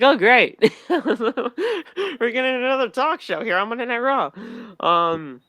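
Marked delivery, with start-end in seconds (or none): 0.0-4.3 laughing through a sarcastic oh great; louder, amused and fed up. Real, not acted. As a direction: chuckle out the disappointment. Sarcastic reaction to talk show.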